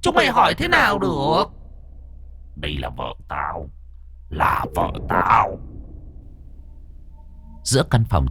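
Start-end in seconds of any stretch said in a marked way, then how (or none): 0.0-5.6 eerie ghost voice. Acted. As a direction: speak as a possessing spirit. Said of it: "Nó cất cái giọng ma quái ghê rợn".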